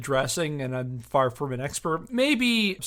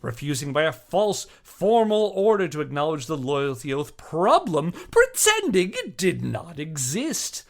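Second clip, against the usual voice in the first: louder, declaiming each charge like a prosecutor; dramatic letter reading; 4 semitones higher, 8 dB louder than usual. Acted. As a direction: read it out as a righteous indictment. Reads the accuser's letter aloud.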